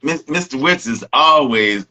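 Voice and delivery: usual speaking voice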